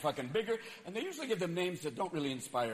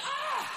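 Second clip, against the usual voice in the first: high yelp of fright; startled scream. Retold or imitated. A quake survivor screaming mid-quake. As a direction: scream as the ground shakes. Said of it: "That was like".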